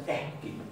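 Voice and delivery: eski with a breathy h before the k; Icelandic ekki as eski. Shown as a demonstration. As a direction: say eski as ehki, a puff of air then k. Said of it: "Y esto se va a pronunciar eski".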